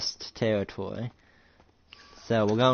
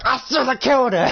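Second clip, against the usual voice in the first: very high, strained yell; enraged scream; 13 semitones higher, 4 dB louder than usual. Acted. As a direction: cry out in acted fury. Acted rage, punching wall.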